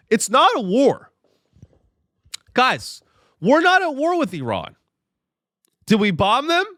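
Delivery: high, loud mock-innocent denial; sarcastic official spin. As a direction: play the official dodging the word war.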